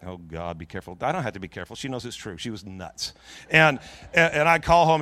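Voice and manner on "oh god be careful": hushed, low warning under the breath; quiet, cautioning aside; wary warning. Acted. Men in the audience warning him, hushed. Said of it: "looking at me like".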